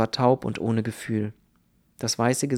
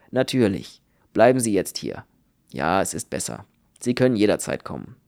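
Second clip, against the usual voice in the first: firm doctor's voice, louder in bursts; brisk, obliging doctor; 3 semitones lower, 4 dB louder than usual. A narrator's reading. Doctor to Kern as Ruth is driven off, brisk and obliging. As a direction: reassure with businesslike authority.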